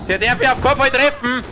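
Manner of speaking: high, loud tense shout; urgent yell while dodging. Real, not acted. Dodging enemy.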